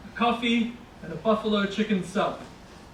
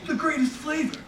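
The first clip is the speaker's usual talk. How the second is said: enthusiastic stranger; 8 semitones higher than usual. Retold or imitated. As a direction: gush over the sandwich like an overeager local. Stranger voiced.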